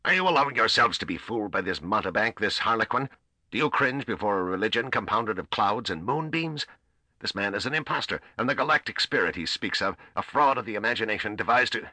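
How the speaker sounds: high-pitched, ranting outrage; losing his head. A narrator's reading. Admiral denouncing the priest to his soldiers, losing his head. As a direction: rant frantically at your men until cut off. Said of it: "as he lost his head entirely".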